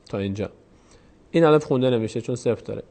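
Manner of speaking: ordinary delivery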